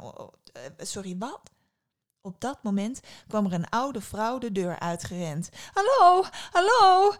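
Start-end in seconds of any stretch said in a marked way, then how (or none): 0.0-1.5 quiet, hesitant surprise; baffled. A narrator's reading. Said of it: "keek het meisje verbaasd aan". 5.7-7.1 very high, loud hooting hello; loud, high-pitched old woman's cry; old woman calling out. A narrator's reading. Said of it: "riep de oude vrouw uit".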